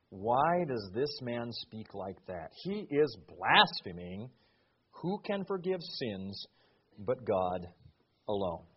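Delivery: low, soft, slow doubting scribes; inner grumbling. Acted. Scribes doubting Jesus in their hearts, low and slow. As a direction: mutter it as thoughts kept to themselves.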